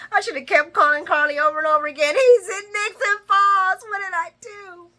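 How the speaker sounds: high frantic wail, imitated; hammed-up panicked lament; mock panic. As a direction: rush in, then wail the regret. Voicing Nina's panicked regret.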